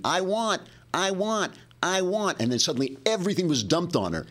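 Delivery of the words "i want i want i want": high, insistent whining cry; mock scream. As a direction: wail it like a demanding child. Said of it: "a cartoon of a woman screaming".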